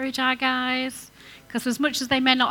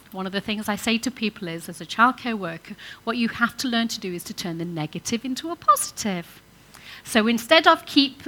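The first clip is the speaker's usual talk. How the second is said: ordinary delivery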